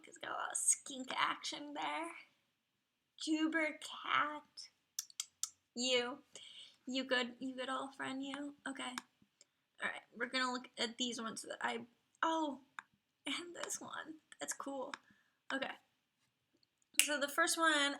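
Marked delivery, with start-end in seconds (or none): 0.1-2.1 a hushed confiding whisper; whispered aside. Real, not acted. Whispered aside while browsing. 13.2-14.9 hushed, much softer murmur; whispered aside. Real, not acted. Whispered aside while browsing.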